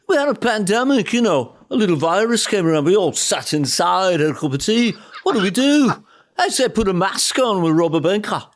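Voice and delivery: breezy Liverpudlian shrug at bad news. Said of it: "I like being [name]".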